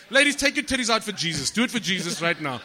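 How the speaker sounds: loud, high preacher call; hammed-up revival preacher shouting; mock preacher. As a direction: whip up the congregation like a hammed-up preacher. Preacher-style call to ladies.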